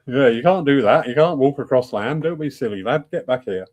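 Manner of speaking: mock northern English telling-off; northern accent. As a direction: scold a lad in a northern English accent, starting loud. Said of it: "suddenly got a northern accent".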